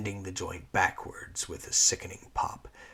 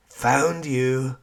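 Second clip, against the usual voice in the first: playful gotcha, louder; teasing; 7 semitones higher, 10 dB louder than usual. Acted. Lyra catching her former master, teasing. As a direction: tease your caught prey. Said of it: "teased Lyra".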